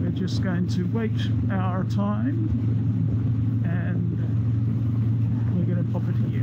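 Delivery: talking inside a motorcycle helmet; muffled helmet mic over engine noise. Real, not acted. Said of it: "in-helmet sound check".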